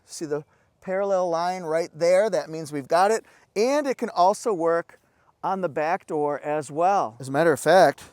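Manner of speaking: normal talking voice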